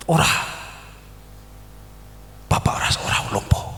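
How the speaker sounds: low whispered denial, quick; hushed and firm, retold; hushed reassurance. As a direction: imitate a wife quietly insisting. Wife firmly reassures him, retold.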